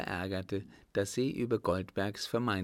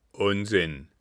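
loud, disbelieving outburst; blunt loud scoff; scoffing shout; 7 dB louder than usual. Acted. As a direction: bark it out in disbelief.

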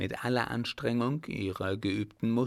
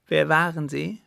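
louder, higher, urgent; urgent eager question; 5 semitones higher, 7 dB louder than usual. Acted. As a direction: she must know right now. Urgent question about his parents.

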